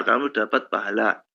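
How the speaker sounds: usual speaking voice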